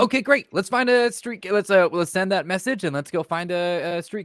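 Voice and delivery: high, loud rush to go; bursting with eagerness; excited. Real, not acted. Excited shout to go.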